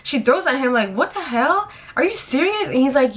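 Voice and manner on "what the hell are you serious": imitating incredulity, pitched high; acted-out appalled question; retold shocked disbelief. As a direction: climb higher in mock disbelief. Cousin's retold shocked reaction.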